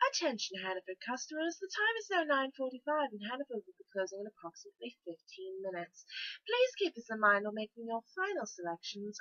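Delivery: soft, lilting British store announcer; smooth British-accented tannoy patter, softly spoken; British closing announcement. Acted. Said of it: "said in a British accent is the closing announcement".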